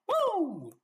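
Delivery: a high, loud woo of disbelief; shocked whoop. Real, not acted. Preacher whooping at a terrible prophecy, stunned.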